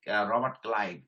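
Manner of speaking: ordinary talk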